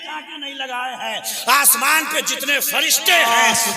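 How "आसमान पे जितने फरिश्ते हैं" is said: high, loud sermon shout; raised, ringing preacher's delivery; full-force preaching. Real, not acted. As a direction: proclaim it to the whole crowd at full voice.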